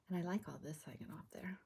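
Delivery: fast, whispered mumble to herself; mumbling. Real, not acted. Crafter murmuring to herself while trimming, fast whisper. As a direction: mutter it under your breath, quickly. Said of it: "Am I mumbling?".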